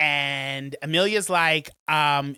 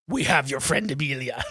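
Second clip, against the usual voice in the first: Gustavo; 4 semitones lower than usual. Retold or imitated. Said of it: "Gustavo says".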